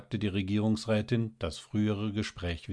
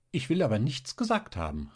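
higher-pitched, mild outcry; lightly exclaimed protest; 6 semitones higher than usual. A narrator's reading. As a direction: call it out as a quick disclaimer. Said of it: "rief Luise Hechner".

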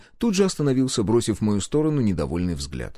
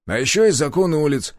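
higher-pitched smirking comeback; 6 semitones higher, 4 dB louder than usual. A narrator's reading. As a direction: answer back with a crooked smirk. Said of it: "криво ухмыльнулся мужик". (с кривой ухмылкой)

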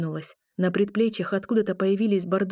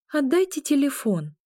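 demanding; 7 semitones higher than usual. Acted. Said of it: "произнесла я требовательно".

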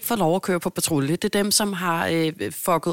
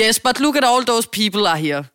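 loud, higher, dismissive clerk; hammed-up receptionist pointing at the queue, louder; mock bossy clerk; 5 semitones higher, 6 dB louder than usual. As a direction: wave the crowd at him, bossily. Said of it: "så var hun sådan".

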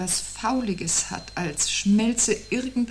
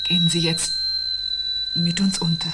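louder, bitter reply; louder grim satisfaction; grim raised voice; 4 dB louder than usual. Acted. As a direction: let the grim satisfaction carry. Grim retort over the tone.

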